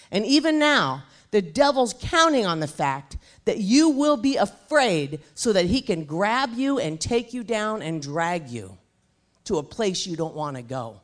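loud, driving preacher's voice; preaching at full force. Acted. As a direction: preach it hard to the crowd. Preaching about fear to congregation.

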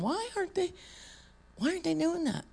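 incredulous. Real, not acted. Incredulous rhetorical question.

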